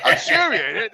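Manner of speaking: ordinary talk, nothing marked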